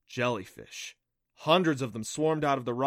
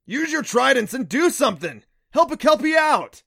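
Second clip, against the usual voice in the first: high, loud demand for help; urgent shouting; 13 semitones higher, 12 dB louder than usual. A narrator's reading. Stung Neff demanding the riders' help, shouting urgently. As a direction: shout it, climbing higher as you go. Kelpie pleading for help under attack.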